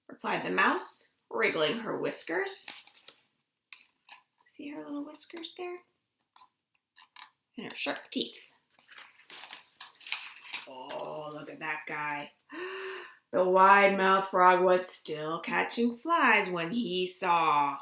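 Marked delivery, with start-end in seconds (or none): 4.5-12.3 whispered aside over the picture book. Real, not acted. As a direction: hush it like sharing a secret. Pointing out picture to children.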